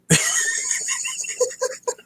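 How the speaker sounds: high squealing laugh; helpless giggle. Real, not acted. To his viewers after the knock-knock joke, a helpless laugh. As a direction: laugh you can't hold back. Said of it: "Can't help it".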